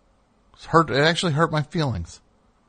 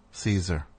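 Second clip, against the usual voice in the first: usual speaking voice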